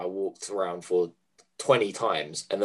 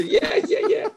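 laughing; slower than usual. Real, not acted. Laughing through the old man bit.

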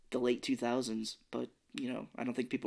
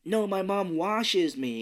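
slow, higher, spelled out for strangers; slow miming explanation; 6 semitones higher, slower than usual. Retold or imitated. Kid explaining to monkey creatures, slow and over-clear. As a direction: explain it slowly like miming to foreigners. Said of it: "he's like".

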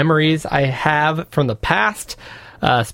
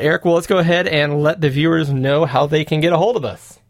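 ordinary talk

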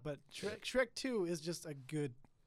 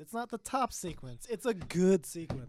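mock lecture; 3 semitones higher, 10 dB louder than usual. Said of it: "let me hear your language back to you".

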